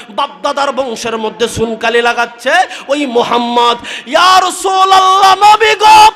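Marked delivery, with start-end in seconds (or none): 4.1-6.1 crying out. Acted. Preacher cries out to the Prophet.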